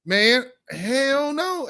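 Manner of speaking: slow, stretched-out hell no; drawn-out emphatic refusal. Real, not acted. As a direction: drag out hell no with disbelief. Outraged at caucus rallying.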